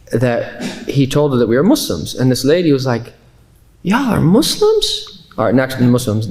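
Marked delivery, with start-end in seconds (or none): 3.8-5.3 high, drawn-out Southern incredulity; slow, high Southern twang; Southern accent. Retold or imitated. Astonished Subway worker questioning two Muslims, strong Southern accent. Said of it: "in a very Southern accent".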